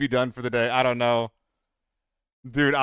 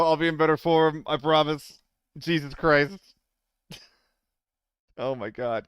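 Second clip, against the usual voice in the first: ordinary delivery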